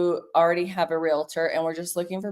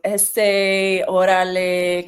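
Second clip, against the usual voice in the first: put-on East LA accent; 4 dB louder, much slower than usual. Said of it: "they have that whole East L A".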